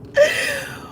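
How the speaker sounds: short shrieking laugh, pitched high. Real, not acted. Streamer cracking up at chat, high shriek. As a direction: let out one high helpless laugh.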